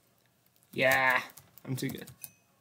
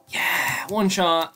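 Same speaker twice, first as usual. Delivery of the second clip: loud triumphant yell; triumphant cheer; 5 semitones higher, 8 dB louder, much slower than usual. Real, not acted. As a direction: cheer the kill.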